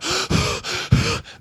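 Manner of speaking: imitated panicked gasps; acted heavy, frightened gasping; terrified panting. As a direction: pant hard like someone scared breathless. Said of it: "and he's going".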